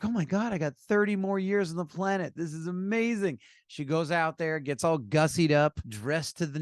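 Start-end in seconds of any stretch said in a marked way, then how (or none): 0.0-3.4 high-pitched, gushing mock excitement; hammed-up elation, voice lifted high; acted delight. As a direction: play a woman thrilled at her luck. Joke woman thrilled with extra years.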